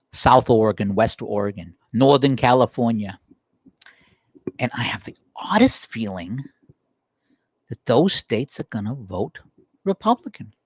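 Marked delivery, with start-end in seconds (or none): 4.6-10.5 conspiratorial whisper; sly whisper. Real, not acted. Teasing a prediction to viewers, sly whisper. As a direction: lean in and whisper the hunch. Sly aside about new states.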